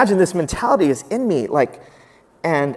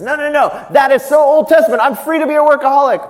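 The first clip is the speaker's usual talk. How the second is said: put-on "that is so Old Testament" whined much higher and louder; mocking dismissal; 10 semitones higher, 9 dB louder than usual. Preacher mimicking a smug workaholic brushing off Sabbath, mocking. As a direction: sneer it like a smug excuse.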